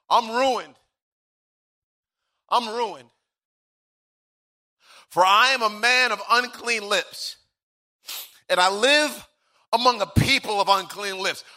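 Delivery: high, anguished confession in halting phrases; Isaiah. Acted. Prophet confessing unclean lips before God, anguished lament. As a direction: lament your own ruin, leaving long pauses. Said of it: "Isaiah says".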